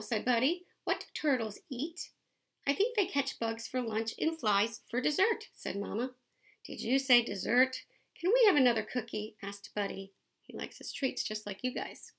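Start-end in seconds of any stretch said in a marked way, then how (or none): 0.0-10.1 lively picture-book read-aloud; reading to children. A narrator's reading. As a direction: read it out to the kids, bright and clear.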